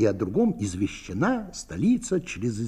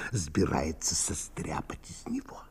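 whisper; 6 semitones lower, 10 dB quieter than usual. Acted. Whispered gossip aside.

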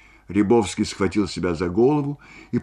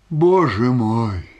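loud drawn-out moan; long, despairing lament; 4 semitones higher, 6 dB louder, much slower than usual. A narrator's reading. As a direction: groan it out slowly, louder and higher. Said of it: "простонал Рябовский".

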